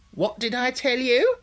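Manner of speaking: high-pitched hen's exclamation; high, rising told-you-so; crying out triumphantly. A narrator's reading. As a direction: crow it as a vindicated hen. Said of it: "cried the hen".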